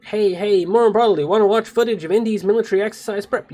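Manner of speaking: ordinary talk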